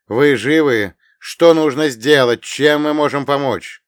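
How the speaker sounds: urgent, loud, almost shouting; agitated near-shout. Acted. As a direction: call out anxious over the radio. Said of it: "взволнованный голос Артамонова, который почти кричал".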